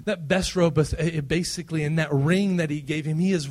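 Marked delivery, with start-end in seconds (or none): none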